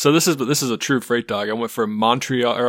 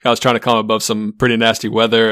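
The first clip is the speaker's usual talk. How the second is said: usual speaking voice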